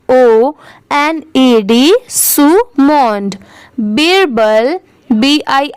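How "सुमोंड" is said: सुमोंड slowly, split as सु-मोंड; syllable by syllable. Shown as a demonstration. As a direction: sound out सुमोंड in two drawn-out parts. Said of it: "इसकी pronunciation है सुमोंड". (सुमोंड को धीरे-धीरे तोड़कर बोलें)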